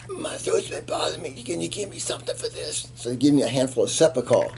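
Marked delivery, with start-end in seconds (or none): 0.1-2.9 high, soft put-on voice of a sick soldier; mock pitiful plea for throat medicine; whiny sick soldier. Soldier begging the medic chopper for throat meds, high pitiful voice.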